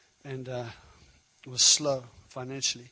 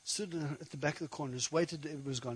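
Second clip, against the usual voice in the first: normal talking voice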